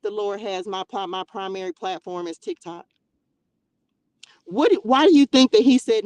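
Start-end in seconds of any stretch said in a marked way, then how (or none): 4.5-6.0 loud, rising rebuke; fired-up preacher shout; loud preaching. Real, not acted. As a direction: call them out from the pulpit. Preacher rant at viewers.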